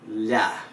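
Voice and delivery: "La." with a slender L. Shown as a demonstration. Teacher shows mouth shape to learners, slender L. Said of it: "it's the shape of your mouth".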